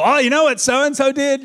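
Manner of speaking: high, loud indignant gossip; outraged, telling tales; indignant complaint. Retold or imitated. Pastor complaining to his wife Nicole, loud and indignant.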